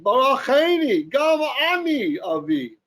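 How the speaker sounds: drawn-out, high-pitched plea; mild theatrical cry in Hebrew; loud bitter cry. Acted. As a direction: cry it out slowly to your father. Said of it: "he cried out with a loud shout".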